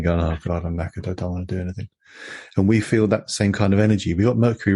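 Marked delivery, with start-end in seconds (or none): 0.2-1.9 softer, mock-exhausted aside as a panting dog; a hint of a worn-out, panting dog; lightly voiced tired dog. Speaking for dogs lying in the hot sticky weather, mildly mock-exhausted. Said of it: "lying there panting".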